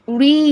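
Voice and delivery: রি held out long; long vowel. Shown as a demonstration. Teacher showing how we pronounce these, রি drawn out. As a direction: stretch the vowel in রি. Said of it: "এই যে আমরা উচ্চারণটা করছি".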